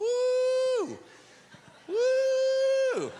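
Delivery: high, drawn-out "woo"; high preacher's whoop of delight; excited whoop. Real, not acted. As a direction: let out a long high-pitched whoop. Excited preacher whoops between points.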